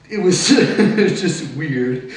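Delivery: slow, loud words through a laugh; amused, laughter breaking into the words; real laughing. Real, not acted. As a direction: laugh as you admit it. Laughs at his own awkward situation.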